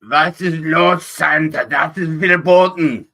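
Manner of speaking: loud, high, slow German scolding; angry German elf. Acted. German elf telling off Santa, angry shout. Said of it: "He always sounds angry".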